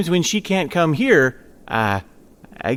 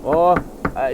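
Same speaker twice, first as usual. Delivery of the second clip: normal talking voice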